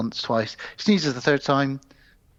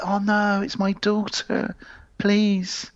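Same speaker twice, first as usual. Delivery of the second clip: pleading father; 6 semitones higher, slower than usual. Acted. William begs the leprechaun for his daughter, mock pleading. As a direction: plead in hammed-up dismay. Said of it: "it's William's like".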